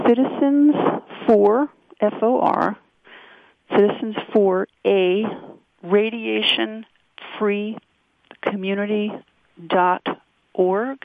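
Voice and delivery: slow dictation with pauses between words. Real, not acted. Guest repeats a website for the host's listeners, very slow. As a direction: spell it out slowly, word by word. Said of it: "Can you say it very, very slow".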